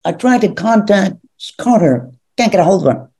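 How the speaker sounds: over-the-top, high-pitched captain melodrama; hammed-up starship captain; Kirk impression. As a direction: overplay a desperate captain's report, haltingly. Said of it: "this over the top Kirk thing".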